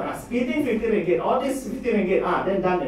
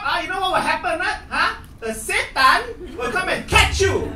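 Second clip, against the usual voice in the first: teacher threatening; 10 semitones higher, 5 dB louder than usual. Retold or imitated. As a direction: threaten the kids with a lightly hammed menace. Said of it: "She snapped, scold all the children".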